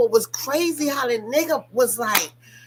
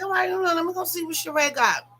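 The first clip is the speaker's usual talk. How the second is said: pitched-up, eager little aside; eager curiosity; 9 semitones higher, faster than usual. Retold or imitated. Host retells eagerly checking the website, high and curious. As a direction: bounce up in voice, curious to see. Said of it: "And I was like".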